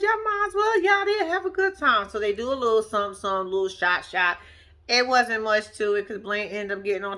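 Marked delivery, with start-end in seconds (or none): 0.0-2.1 mock cheerful send-off. Talking to the TV couple.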